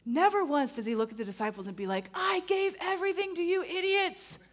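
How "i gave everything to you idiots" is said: high, put-upon mock scolding; condescending tone. Pastor voicing a resentful Jesus to the disciples, high mock sneer. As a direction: sneer at the idiots you carried. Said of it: "That's not his tone".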